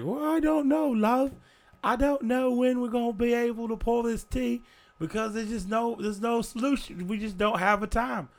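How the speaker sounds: falsetto lady slipping to Southern; accidental country lady. Acted. The Victorian lady drifting into a high country accent. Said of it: "I don't know why I'm country now".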